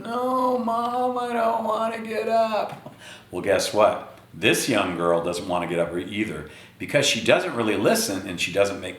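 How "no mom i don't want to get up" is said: put-on high, slow whining child; whiny kid. Kid refusing to get up.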